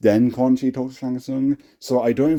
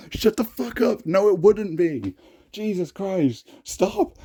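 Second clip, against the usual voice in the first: much higher, exasperated yelling at the page; exasperated outburst; 9 semitones higher, slower than usual. Real, not acted. Creator scolding his fourteen-year-old self's line, exasperated and high. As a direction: scold it in high-pitched disbelief.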